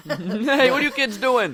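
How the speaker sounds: loud, high scolding grown-up voice; scolding adult. Acted. Mock adult scolding kids who keep shooting, loud and high. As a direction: yell at the kids to knock it off.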